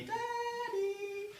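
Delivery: put-on high sing-song child's call, soft and distant; small voice singing out for a parent. Lost child calling dad in the woods, sing-song.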